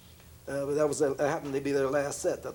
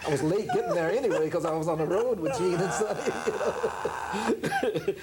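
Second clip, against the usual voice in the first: laughing protest; 3 semitones higher than usual. Real, not acted. Protesting fake orders, laughing.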